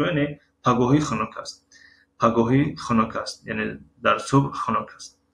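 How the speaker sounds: normal talking voice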